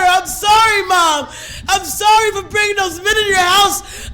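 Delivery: loud, high tearful shouting; anguished outcry. Retold or imitated. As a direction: pour out grief and remorse at full voice. Anguished apology to dead mother.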